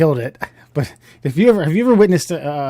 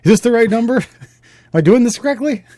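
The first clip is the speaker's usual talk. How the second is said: higher, louder, flustered caller on the phone; unsure caller; 6 semitones higher, 4 dB louder than usual. Retold or imitated. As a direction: play the nervous first-time claimant. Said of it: "dial the one eight hundred number".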